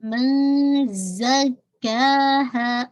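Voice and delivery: very slow من زکاہا with a buzzing ز and a hidden nasal ن; tajweed demo. Shown as a demonstration. Teacher models من زکاہا for her students, slow and buzzing. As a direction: recite من زکاہا slowly, holding the ز buzz. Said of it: "میں زا کی sound نکالے".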